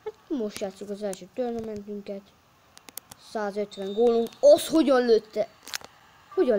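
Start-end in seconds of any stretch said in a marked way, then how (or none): 4.4-6.6 loud stunned question; shocked. Real, not acted. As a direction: ask it stunned. Shocked at opponent goal.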